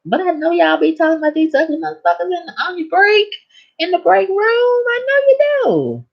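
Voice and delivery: high fast squeaky accusing voice, slowing at the end; mock squeal. Patient teasing the doctor about gossip, high mocking squeal.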